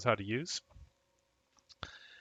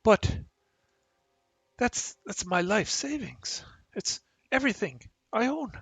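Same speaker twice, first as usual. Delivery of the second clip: pleading victim; 12 semitones higher, 3 dB louder than usual. Acted. Robbed man pleading with orc for his savings, helpless. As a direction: play a helpless man begging in a high-pitched voice. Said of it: "And the man just".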